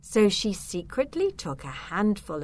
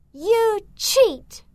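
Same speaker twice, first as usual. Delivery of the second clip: high, loud accusing yell; furious yell; 8 dB louder than usual. A narrator's reading. Zelda furious at the rival far ahead, yelling. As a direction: yell it at your cheating rival. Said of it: "she yelled".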